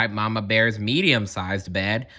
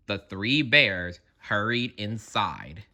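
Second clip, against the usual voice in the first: ordinary delivery, nothing marked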